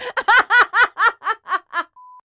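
big loud burst of laughter; loud delighted laugh. Real, not acted. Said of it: "screaming in delight".

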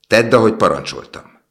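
high, loud insistent order; firm command. A narrator's reading. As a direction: order it forcefully, punching the first word. Prince repeats his command.

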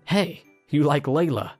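put-on high, coy girl voice; imitating Layla. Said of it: "after imitating Layla and caressed my hair".

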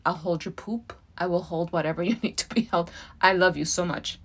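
usual speaking voice

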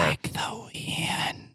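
slow, drawn-out whisper; hushed whisper. Acted. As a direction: whisper it like a passing breeze. Stealth joke, breathy whispered aside.

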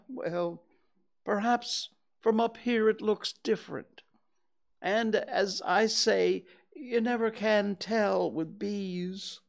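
a worried bear dangling from a balloon; Pooh voice. Acted.

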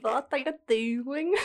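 Dexter's Laboratory impression. Doing her Dexter impression for a friend, slow and high. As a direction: stretch it out like the kid genius. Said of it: "with Dexter".

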